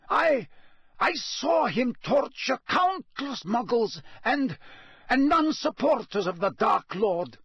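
high, hasty pleading; hurried, nervous. A narrator's reading. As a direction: rush to explain. Karkaroff nervous witness.

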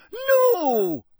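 high, loud drawn-out cry; long appalled wail. Acted. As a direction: cry out in horror. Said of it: "cried Professor McGonagall".

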